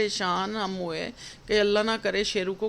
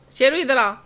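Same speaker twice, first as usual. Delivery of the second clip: calling out; 5 semitones higher, 6 dB louder than usual. Real, not acted. Calling the dog across the house.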